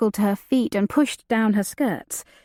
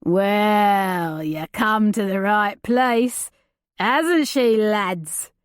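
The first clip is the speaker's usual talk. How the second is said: leering brute; much slower than usual. Acted. The big man leering.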